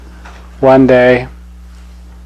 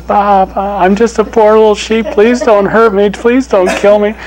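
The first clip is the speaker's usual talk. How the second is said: much higher, pleading, timid bleat; frightened little sheep; 8 semitones higher, 4 dB louder than usual. Acted. Lion cub who thinks he's a sheep, begging in fear. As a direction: beg for mercy like a tiny lamb. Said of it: "He says".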